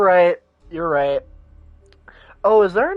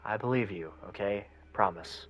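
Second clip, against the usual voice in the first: low, gentle detective voice; softened detective; 4 semitones lower, 6 dB quieter than usual. Acted. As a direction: reassure the kid in a lower register. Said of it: "something comes over the detective and he softens".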